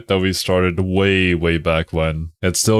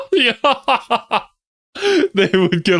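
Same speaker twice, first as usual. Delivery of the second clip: laughing; 14 semitones higher, slower than usual. Real, not acted. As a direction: laugh through the word. Laughing hard.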